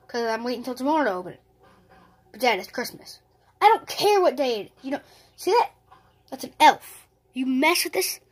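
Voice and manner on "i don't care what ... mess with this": loud, higher scolding dad; hammed-up furious dad; angry scolding. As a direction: snap at the kid, getting louder.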